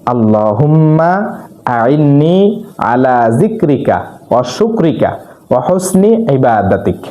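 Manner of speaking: very slow, drawn-out Arabic recitation; measured teaching recital, syllables held long; dua recitation. Shown as a demonstration. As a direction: recite it slowly for learners, stretching the long vowels. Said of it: "দোয়াটা হচ্ছে".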